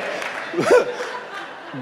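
high airy burst of laughter; real breathy laugh. Real, not acted. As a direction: laugh helplessly at yourself. Preacher still laughing.

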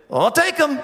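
high holler from far off; yelled reply. Retold or imitated. Trader accepting the deal across the river, high yell. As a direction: imitate a distant shout back. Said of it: "I screamed into Mexico".

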